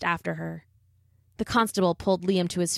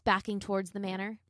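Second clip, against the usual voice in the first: plain reading voice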